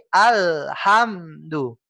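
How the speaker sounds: very slow alhamdu with a gap after Al and after ham; slowed choppy reading. Shown as a demonstration. Teacher slowing down the wrong reading, cut into pieces. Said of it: "Kalau kita lambatin lagi".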